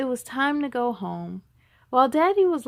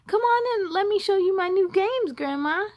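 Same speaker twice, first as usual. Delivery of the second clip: put-on eager child; 9 semitones higher, 3 dB louder than usual. A narrator's reading. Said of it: "encouraged B J".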